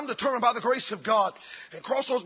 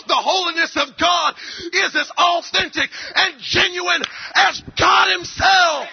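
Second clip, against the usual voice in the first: shouted, louder and higher sermon climax; full-force preaching; 8 semitones higher, 7 dB louder than usual. Acted. Pastor rousing his church, shouting at full force. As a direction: hammer it home to the congregation.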